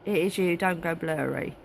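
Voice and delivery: ordinary talk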